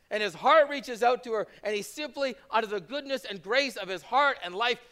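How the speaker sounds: loud, high, impassioned sermon; full-force preaching. Acted. Pastor urges the congregation, loud impassioned preaching. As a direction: drive the gospel home to them.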